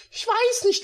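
high, sad heroine voice; sad girl voice. Acted. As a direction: mumble like a sulking girl. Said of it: "murmelte sie traurig".

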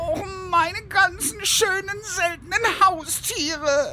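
high, tearful wail over lost pets; hammed-up weeping; crying lament. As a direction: sob it out like the pets are gone forever. Mourning lost rare pets.

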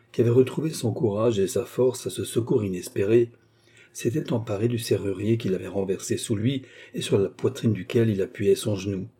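usual speaking voice

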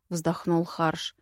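usual speaking voice

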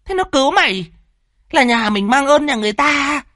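high-pitched indignant lecture; mother's strained, insistent telling-off; angry reproach. Acted. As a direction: scold with anger not yet cooled. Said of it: "thế nhưng cơn tức giận".

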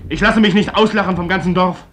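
defiant outburst. Acted. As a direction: flings it at her, refusing to be held back.

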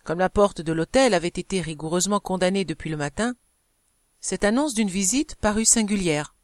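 ordinary delivery

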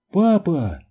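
high, scared shout; a daughter crying out as her father faces a gun; frightened cry. A narrator's reading. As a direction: cry out in fear for him. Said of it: "испуганно закричала Бетти".

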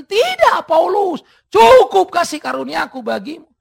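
a high, loud, commanding answer; God booming no; God refusing. Acted. God refuses the third cry. (jawaban Tuhan yang lantang)

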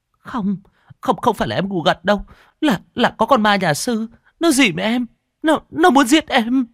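high, loud panicked voice; terrified insisting. A narrator's reading. Frightened woman insists a ghost monk attacked her.

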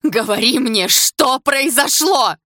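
high, loud, drawn-out demand; full-lung scream. A narrator's reading. Polina demanding answers from silent Sasha, full-lung scream. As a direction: scream it out, stretching the last words. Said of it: "закричала во всю мощь лёгких".

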